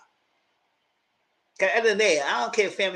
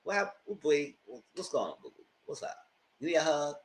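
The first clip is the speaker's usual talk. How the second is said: softer, gentle coaxing; soft caring questions; 2 semitones lower, 10 dB quieter than usual. Retold or imitated. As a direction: coax her gently like a friend. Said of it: "I was like".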